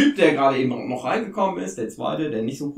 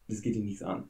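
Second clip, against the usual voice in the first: leise abweisend; 8 semitones lower, 15 dB quieter than usual. Acted. GM voices gruff NPC.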